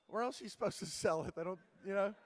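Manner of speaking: playing his younger clueless self, genuinely puzzled; incredulous protest.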